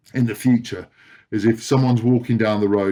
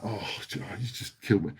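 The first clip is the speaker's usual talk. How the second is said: dismayed mutter; 12 dB quieter than usual. Retold or imitated. Inner groan after bad news.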